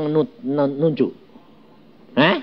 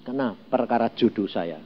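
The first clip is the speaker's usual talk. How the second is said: ordinary talk, nothing marked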